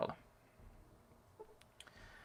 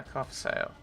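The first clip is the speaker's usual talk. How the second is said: usual speaking voice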